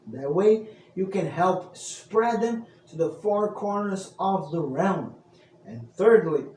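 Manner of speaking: loud, bright-eyed storyteller welcome; booming minstrel introduction; bardic host greeting. Acted. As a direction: hail the traveler like a tavern bard. Storyteller persona outro and sign-off.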